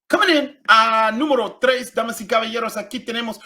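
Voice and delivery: high, loud host voice; loud game-show countdown; countdown announcer. Acted.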